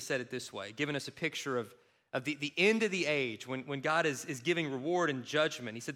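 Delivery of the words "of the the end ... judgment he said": ordinary talk, nothing marked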